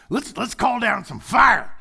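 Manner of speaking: loud, high, slow southern drawl; hammed-up southern holler; southern accent. Disciples wanting fire from heaven, mock southern accent. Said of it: "they said it with a southern accent".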